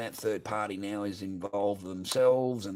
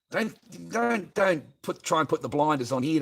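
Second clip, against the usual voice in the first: heated rant; 5 semitones higher, 4 dB louder than usual. Real, not acted. Ranting at a viewer.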